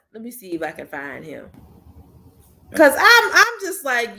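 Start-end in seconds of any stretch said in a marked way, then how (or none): none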